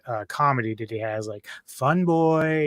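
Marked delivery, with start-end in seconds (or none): 1.7-2.7 a mock sarcastic jab, said higher; tossing off a snide nickname; sarcastic quip. Host imitating the hero's sarcastic jab at a thug. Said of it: "It's like a sarcastic".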